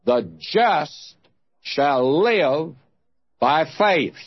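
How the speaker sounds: "The just", "shall live", "by faith", each set apart by a pause; weighty, phrase by phrase. Shown as a demonstration. Preacher holds up those tremendous words, pausing after "just" and "live". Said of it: "take those tremendous words".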